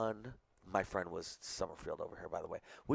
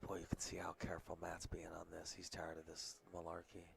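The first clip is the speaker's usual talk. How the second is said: whispering; 11 dB quieter than usual. Real, not acted. Commentator talking during a shot.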